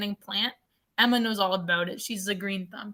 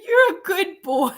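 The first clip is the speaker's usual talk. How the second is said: high, slow doggy-praise voice; talking to dog; 8 semitones higher, 7 dB louder, slower than usual. Retold or imitated. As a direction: coo it loudly to the pet, stretched out. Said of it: "And I was like".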